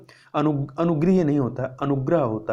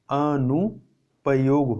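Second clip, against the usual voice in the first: अनुपयोग said very slowly and drawn out; slow emphasis; much slower than usual. Shown as a demonstration. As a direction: spell out अनुपयोग slowly for students. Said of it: "अनुपयोग होता है".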